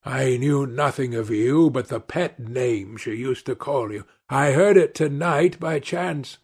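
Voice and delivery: ordinary delivery, nothing marked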